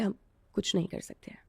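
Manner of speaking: hushed, beaten whisper of a poor father; defeated whisper. Retold or imitated. Father tells his crying daughter they are powerless, whispered. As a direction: whisper it like there is nothing to be done. Father's helpless reply retold.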